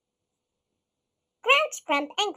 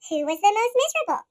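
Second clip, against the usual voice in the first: ordinary delivery, nothing marked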